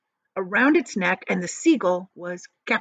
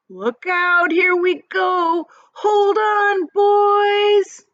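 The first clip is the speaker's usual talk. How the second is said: loud, high excited shout; thrilled high yell; shouted warning; 13 semitones higher, 8 dB louder, much slower than usual. Acted. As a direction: warn everyone to hold on. Peach starts rising, warning shout.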